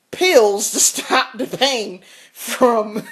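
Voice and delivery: slow, giggling through the line; can barely get it out for laughing; laughing through words. Real, not acted.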